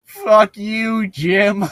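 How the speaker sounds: high laughing snap; Jim's retort. Acted. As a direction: spit it out at Jim, laughing. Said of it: "Jim says".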